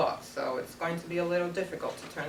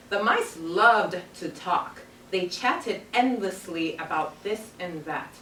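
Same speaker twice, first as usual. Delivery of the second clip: put-on reading aloud to children; slower than usual. Teacher reading the story to her class, storybook voice.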